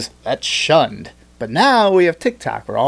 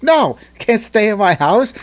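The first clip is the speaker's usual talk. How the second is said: higher, louder panicked refusal; mock flustered refusal; 8 semitones higher, 4 dB louder than usual. Biden supporter refusing immigrants into his house, flustered. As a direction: blurt it like a caught hypocrite.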